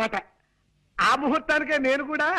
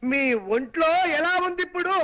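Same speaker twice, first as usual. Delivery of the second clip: shouting to be heard. Acted. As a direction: shout it word by word for a hard-of-hearing elder. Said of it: "అంత గట్టిగా అరవకు నాయనా". (చెవిటివారికి వినబడేలా గట్టిగా అరవడం)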